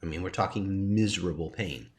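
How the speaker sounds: normal talking voice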